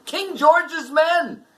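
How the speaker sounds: high, ringing storyteller's shout; dramatic shout. A narrator's reading. Narrator announcing the redcoat troop, dramatic shout. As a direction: burst out loud as the troop arrives.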